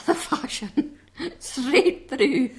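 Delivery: laughing through the words. Real, not acted. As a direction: giggle while repeating the phrase. Laughing at pronunciation.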